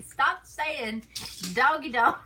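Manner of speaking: "Stop saying doggy dog" called out loud and high; retold scolding. Telling husband off for saying doggy dog, loud. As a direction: scold him for the wrong phrase, half laughing. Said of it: "I'm like".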